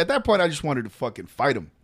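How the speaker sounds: ordinary delivery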